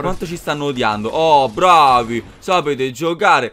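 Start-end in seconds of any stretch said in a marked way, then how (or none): 1.1-3.5 slow, loud mock applause; sarcastic praise. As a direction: drag it out, dripping sarcasm. Mocking the enemy players.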